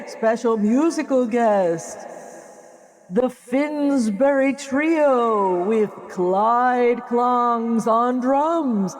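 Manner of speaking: very slow, drawn-out show announcer. Pretend. [name] introduces a fake band in an SNL announcer voice. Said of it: "the Saturday Night Live announcer".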